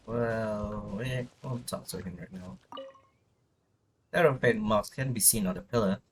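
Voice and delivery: normal talking voice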